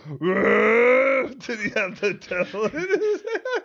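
slowed, drawn-out repeat of the line; slow repeated quote. Retold or imitated. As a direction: repeat it slowly, like a highlight clip. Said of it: "good cut in the middle right there".

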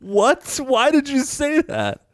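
high, slow, incredulous what; baffled disbelief. Retold or imitated. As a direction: react like you can't believe what you just heard. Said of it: "I was like".

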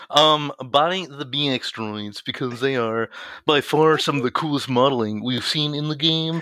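lightly put-on smug nerd voice; sweaty neckbeard voice. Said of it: "sweaty neckbeard voice".

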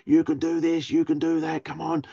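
high-pitched mock cheerleading, relentless; pushy parent. Parent driving an overachieving child, imitated pushy urging. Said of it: "where you see the parents".

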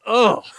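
short disgusted ugh; cringing groan. Real, not acted. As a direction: groan at your own voice. Cringing at old voicemail.

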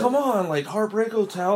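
very high, pleading mock exasperation; freaking out. Dad rocker explaining his cultural icon to her, mock freaking out. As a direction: imitate a fan desperately jogging someone's memory. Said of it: "he was just freaking out".

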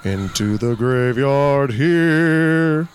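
slow, eerie Ghost Host intro; slow theatrical villain menace. Acted. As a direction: drawl it out like a haunted host.

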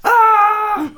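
a sudden high yelp of "ah"; high shout. Real, not acted. Host yelling the word-of-the-day shout at the sticker. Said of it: "you have to yell, "Ah!".

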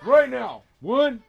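sharp, high parent yell; nagging parent impression. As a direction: stop the kids with one yell. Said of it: "[name] put on the mom voice".

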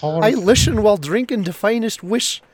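put-on high, loud, slurred drunken Irish brogue; drunken Irish accent. Reading the review aloud, high and loud in a slurred drunken Irish accent. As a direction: read it like a happy pub drunk. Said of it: "drunken Irish accent".

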